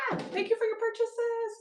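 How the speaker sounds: excited thanks. Real, not acted. Streamer thanking a buyer after a squeal, excited. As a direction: beam the thanks up high.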